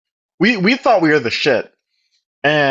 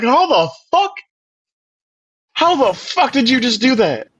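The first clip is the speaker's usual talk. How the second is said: high, stunned "how the fuck"; mock shocked outburst; retold disbelief; 8 semitones higher than usual. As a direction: squeak it out in disbelief.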